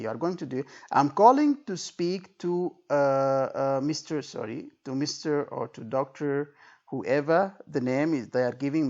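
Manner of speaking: usual speaking voice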